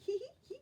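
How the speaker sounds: a high, impish crowing laugh; impish high giggle. Acted. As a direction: cackle like a mischievous fairy.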